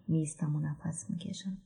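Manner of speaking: soft unvoiced whisper; a hushed whisper while catching breath. A narrator's reading. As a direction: breathe the words out, steadying yourself.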